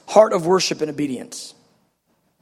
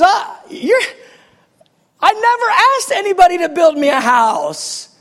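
raised, amused voice of God; voicing God; 8 semitones higher than usual. God answering David's offer of a house, amused. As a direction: answer lightly, a touch amused. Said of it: "He goes".

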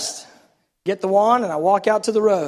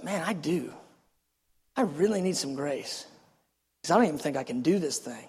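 humbled admission; 2 semitones lower, 7 dB quieter than usual. Retold or imitated. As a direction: admit it quietly, retelling inner thoughts. Retells humbled inner admission.

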